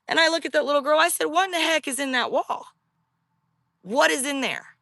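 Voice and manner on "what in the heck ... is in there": higher, startled demand; alarmed. Retold or imitated. Asking the little girl what is in the wall, higher and startled. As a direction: sound spooked and demand an answer.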